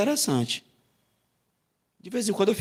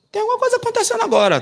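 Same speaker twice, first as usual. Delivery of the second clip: mock answer; 4 dB louder, faster than usual. Mimicking answers from the congregation.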